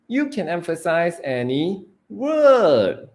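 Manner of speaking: slow, building to a long, loud, high word; word stretched high, then falling; stress on WORD. Shown as a demonstration. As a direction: punch the final word. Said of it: "emphasizing different words".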